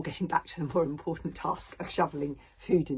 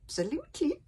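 put-on agreeing old grandfather; 13 semitones higher, 4 dB louder than usual. A narrator's reading. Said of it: "agreed old Wrinkly".

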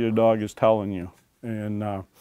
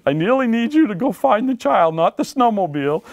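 pleading; 9 semitones higher than usual. Acted. Handler pleading with dog, watched.

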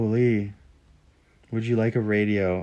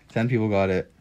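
normal talking voice

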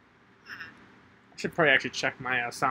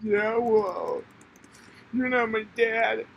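put-on higher, slowed petulant kid; bratty kid voice; 8 semitones higher, slower than usual. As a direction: sass back like a sulky child. Childish retort in game.

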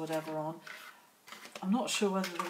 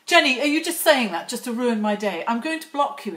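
louder, playfully indignant accusation; mock outrage; 3 semitones higher, 7 dB louder than usual. Teasing viewer Jenny about ruining her day, loud mock indignation. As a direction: pretend to be wounded and cross.

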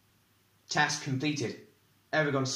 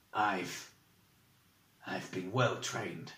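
bitter; 4 semitones lower, 3 dB quieter, slower than usual. A narrator's reading. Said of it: "There was a bitter note to his voice".

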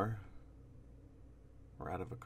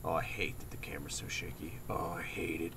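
whispering; 6 semitones lower, 6 dB quieter than usual. Real, not acted. Whispering in fear.

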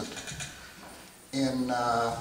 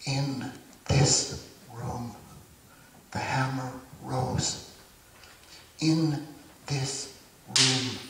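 slow, spaced-out poetry reading; poem recitation; 4 dB quieter, slower than usual. Acted. Poet telling of the killing in this room, solemn poem recitation. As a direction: recite it slowly, pausing between phrases.

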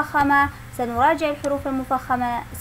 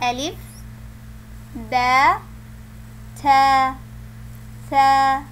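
alphabet recitation; much slower than usual. Shown as a demonstration. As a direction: call out each letter for the children. Recites letters again for comparison.

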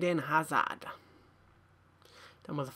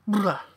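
loud disgusted blah; 4 semitones higher, 12 dB louder than usual. Real, not acted. Disgust at a pack result.

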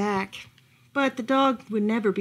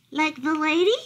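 hopeful; 6 semitones higher, 3 dB louder than usual. Acted. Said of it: "the dog asked hopefully".

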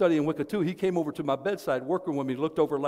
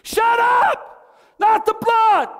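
high shrieking shout of the demon; demon's man voice; 8 dB louder, much slower than usual. Retold or imitated. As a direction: scream it, recoiling from the blood. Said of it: "In a man's voice".

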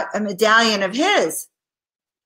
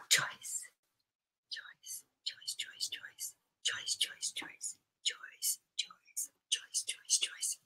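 rapid repeated whisper, barely audible; whispered name; 20 dB quieter, much slower than usual. Real, not acted. Reader summoning a card for Joyce, whispering. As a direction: whisper the name over and over like an incantation. Murmuring client's name before reading.

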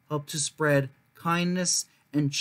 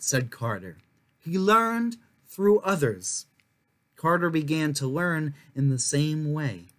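measured, expressive read-aloud voice; storybook narration; 3 semitones higher than usual. A narrator's reading. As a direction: tell it like a picture book for kids. Reads a children's history book aloud.

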